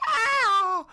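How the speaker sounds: high, loud drawn-out shriek; hammed-up scream of someone stabbed; mock pained scream. Reteller acts out a stab in the stomach, mock scream.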